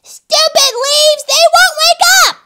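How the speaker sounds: high, loud bully's tantrum; cross, piercing complaint; frustrated yell. Acted. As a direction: shout it in exasperation. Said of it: "who frowned".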